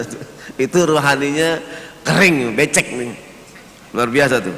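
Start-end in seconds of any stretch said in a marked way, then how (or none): none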